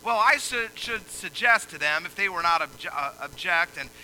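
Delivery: loud, high preaching cadence; fiery pulpit delivery; full-force preaching. Acted. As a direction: preach it out to the hall.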